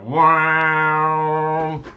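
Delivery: long sustained sung "waaah" like an organ; loud, high held organ drone; imitated organ chord. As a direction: mimic a big opening chord, held. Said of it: "an opening chord".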